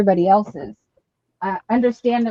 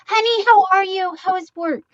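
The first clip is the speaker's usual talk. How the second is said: quoting a friend's greeting; 11 semitones higher, 6 dB louder than usual. Retold or imitated. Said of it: "Jerry was saying".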